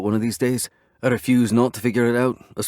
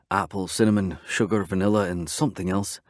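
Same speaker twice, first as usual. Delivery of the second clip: plain reading voice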